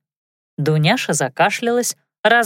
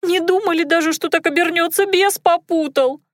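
tearful; 11 semitones higher than usual. A narrator's reading. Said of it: "Дуняша разревелась".

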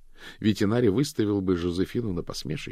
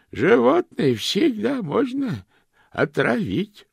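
put-on high, slow, cracked old lady; 8 dB louder, slower than usual. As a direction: croak like a tiny ancient aunt. Old aunt voice continues.

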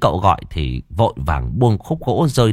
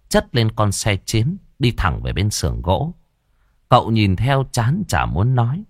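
plain reading voice

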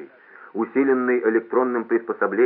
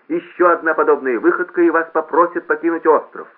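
high, loud public-address threat; raised announcer ultimatum; loudspeaker warning; 7 semitones higher, 7 dB louder than usual. A narrator's reading. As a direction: warn him in front of everyone. Loudspeaker warns rule-breaker before hall.

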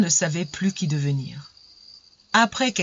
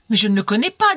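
higher, louder weeping plea; tearful protest; 4 semitones higher, 6 dB louder, faster than usual. A narrator's reading. Frightened fiancée disowning the stranger, tearful protest. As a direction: protest as if in tears. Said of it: "dit-elle en pleurant".

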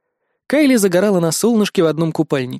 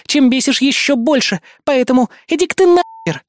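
high, heated accusing rant; indignant tirade; 9 semitones higher, 3 dB louder than usual. A narrator's reading. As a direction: pour out the grievance angrily, pitched up. Indignant woman tirades at narrator.

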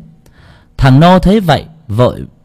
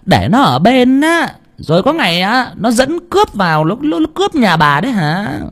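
shrill rant; 11 semitones higher than usual. A narrator's reading. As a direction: rail at him in a high voice. Bà Phú ranting about the boy.

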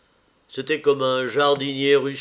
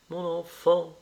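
stagey exclamation, a hint higher; theatrical cry; 4 semitones higher than usual. Acted. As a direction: play it to the gallery. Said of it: "s'écria madame Venn, les yeux au plafond". (s'écria madame Venn, théâtrale)

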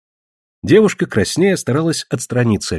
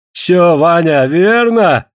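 high, loud exclaimed question; elated old man seeking confirmation; giddy with happiness; 5 semitones higher, 5 dB louder, much slower than usual. A narrator's reading. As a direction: burst out, drunk on joy. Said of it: "пьяняя от счастья, воскликнул Денгура".

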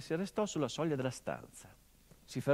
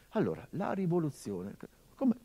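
ordinary delivery

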